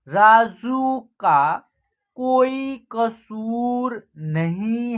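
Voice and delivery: word by word with drawn-out vowels; measured reading practice, pausing between phrases; slow direct reading. Shown as a demonstration. Teacher teaching learners to read these sentences, slow and deliberate. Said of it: "इनको हम direct पढ़ना सिखाएंगे".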